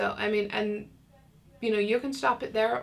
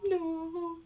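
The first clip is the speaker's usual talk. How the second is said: high, soft sad "No"; a small hurt "No"; 10 semitones higher, 4 dB quieter than usual. Shown as a demonstration. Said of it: "or they might be like".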